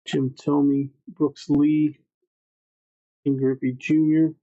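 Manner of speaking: ordinary talk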